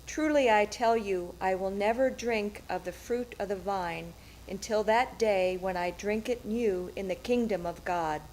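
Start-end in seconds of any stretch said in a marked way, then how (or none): none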